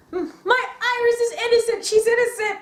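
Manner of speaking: high, fast pleading friend; mock Larry insisting she's innocent; whiny Larry. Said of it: "Larry just, just like".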